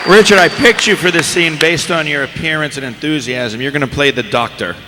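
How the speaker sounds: ordinary talk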